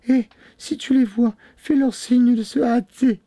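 very high, urgent falsetto; pleading wife. A narrator's reading. Wife calling her sister for help, high and pleading. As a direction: implore in a high woman's voice.

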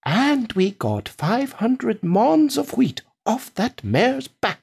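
high, slow farmer's tall tale; high-pitched boasting farmer; boastful farmer. Acted.

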